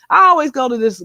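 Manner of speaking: ordinary talk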